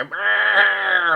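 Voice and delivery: loud, high roaring bleat; mock lamb roar. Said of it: "and some lambs go".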